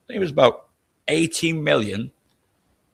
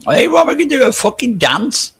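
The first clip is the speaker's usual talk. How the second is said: mocking shout; 12 semitones higher, 7 dB louder than usual. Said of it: "I've seen you do the can-can".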